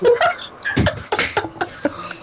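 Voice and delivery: high, breathy falsetto giggle; a quick high giggle at being imitated; falsetto laugh. Real, not acted. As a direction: laugh in a squeaky high wheeze. After friend mimics his voice.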